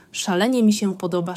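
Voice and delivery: ordinary delivery, nothing marked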